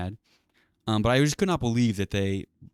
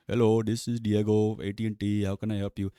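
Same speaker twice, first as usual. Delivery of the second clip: put-on Indian accent; 2 semitones higher than usual. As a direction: imitate an Indian call-centre agent's accent. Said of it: "racist Indian accent".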